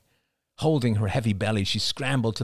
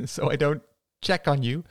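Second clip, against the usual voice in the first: higher voice breaking from a laugh; laugh, then tease the question; laughing suddenly; 5 semitones higher than usual. A narrator's reading. Mother teases son about candy, laughing. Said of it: "Cassie laughed suddenly".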